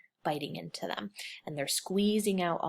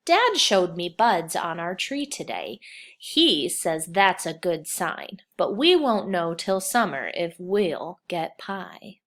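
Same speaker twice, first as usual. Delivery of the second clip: slow, louder storybook reading; reading to children; 7 dB louder, slower than usual. Acted. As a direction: read the story out to the class. Teacher reads picture book aloud.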